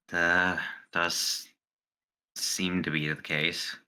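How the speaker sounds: ordinary talk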